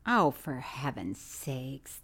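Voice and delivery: soft, slow groan of exasperation; slow exasperated sigh of words. Real, not acted. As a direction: drag it out, fed up. Exasperated at friend.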